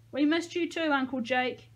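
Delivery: put-on high, chirpy little-girl voice; chirped. A narrator's reading. Caroline tells Uncle Jake they missed him, chirping. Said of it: "Caroline chirped".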